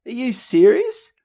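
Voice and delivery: high, loud, stunned What?; shocked disbelief. Retold or imitated. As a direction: blurt it out in pure disbelief. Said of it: "I said".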